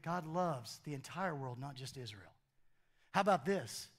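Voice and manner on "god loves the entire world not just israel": dropped low and quiet, almost confiding; soft. Real, not acted. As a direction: say it gently under the breath. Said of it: "Nick, Nick".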